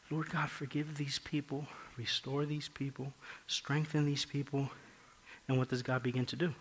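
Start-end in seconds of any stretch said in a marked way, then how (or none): 0.1-4.7 private prayer. Acted. Preacher voicing Ezra praying privately for his people, soft prayer. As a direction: pray it quietly, keeping the volume well down. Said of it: "he's praying to God privately".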